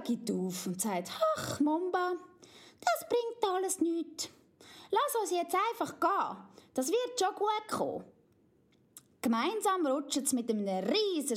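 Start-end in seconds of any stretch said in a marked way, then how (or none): 1.4-8.0 put-on high, eager little girl voice; little girl Amalia. Amalia warns Mumba not to wake Papi, high child voice. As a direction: chatter like a bossy little princess.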